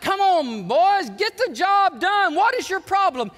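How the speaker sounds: loud, high shout of a tough coach; imitating an impatient coach's holler; in-your-face manager. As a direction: bellow at the team like an old-school manager. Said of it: "He was get in your face".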